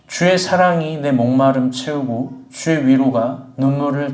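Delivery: low, slow, soft spoken lyrics; recited hymn lyrics. Shown as a demonstration. Pastor reciting hymn lyrics to the congregation, low and slow. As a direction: recite the hymn words gently instead of singing. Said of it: "노래 못 불러드리는데 이 가사말 이겁니다".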